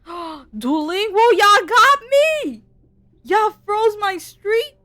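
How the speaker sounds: high, loud, drawn-out gasping joy; slow, thrilled shout at the app; excited disbelief. Real, not acted. Thanking Duolingo for the freeze, loud slow thrilled disbelief.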